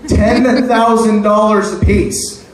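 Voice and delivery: slow, weighty repeat of the price; slow emphatic repeat. Shown as a demonstration. Comic repeating the price to the crowd, slow and emphatic. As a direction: lean on each word, slowly. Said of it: "Let me repeat that for those who didn't hear me".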